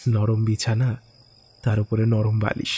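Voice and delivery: ordinary delivery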